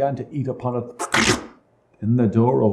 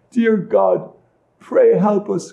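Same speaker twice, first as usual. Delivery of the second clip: higher, pleading prayer; crying out in grief; 8 semitones higher, 4 dB louder, slower than usual. A narrator's reading. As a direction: plead as a grieving little sister. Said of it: "cried she".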